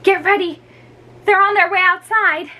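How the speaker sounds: high excited call to the others. Acted. Girls warn each other, excited high call. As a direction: hurry them along, eager.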